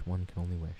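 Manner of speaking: hushed mock-grand echo; mock posh echo. Shown as a demonstration. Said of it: "But one can only wish".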